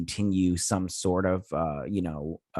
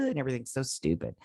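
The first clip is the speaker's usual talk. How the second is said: ordinary delivery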